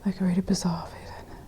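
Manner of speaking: hushed, frightened whisper. Real, not acted. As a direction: whisper it, barely breathing.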